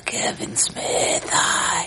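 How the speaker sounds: breathy held-smoke voice; stoner. Pretend. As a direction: speak while holding the hit in. Talks while holding in smoke.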